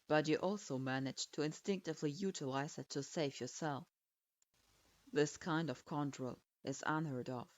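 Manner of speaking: deep, cool authority pressing his point; stern lord voice. Acted. Hyuga-sama speaks intently.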